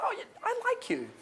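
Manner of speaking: a casual line thrown out as if it just hit him; surprised afterthought. Shown as a demonstration. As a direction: blurt it like it caught you off guard. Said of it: "Almost like I'm shocked that I like them".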